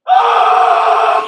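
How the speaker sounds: sudden piercing scream from the shower; a woman shrieking mid-shower; violent scream. Retold or imitated. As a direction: let out a wild, violent scream. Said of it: "like, violently".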